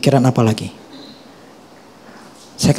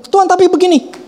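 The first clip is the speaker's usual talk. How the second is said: much higher stubborn retort; arguing back; 14 semitones higher than usual. Retold or imitated. Preacher retells third retort to God, stubborn and high. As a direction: insist once more, pitched up. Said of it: "tiga kali timbalin".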